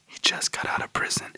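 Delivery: urgent shushing whisper, retold; hushed whisper. Son hushing dad with Carl nearby, whispering. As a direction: whisper it so the guest can't hear.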